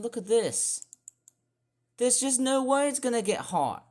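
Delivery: high-pitched exasperated complaint; ropeable. Real, not acted. Despairing of any summer heat, exasperated rant. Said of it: "it just makes me feel ropeable".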